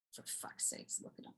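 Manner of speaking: barely audible exasperated whisper; whispered aside. Pretend. Marvelling at him to oneself, whispered aside. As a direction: breathe it to yourself without voice.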